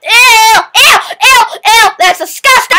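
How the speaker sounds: loud grossed-out yelling; disgusted shout. Real, not acted. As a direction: shriek in real disgust. Sees ants on desktop.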